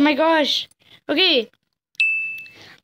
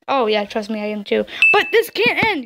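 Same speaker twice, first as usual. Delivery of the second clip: usual speaking voice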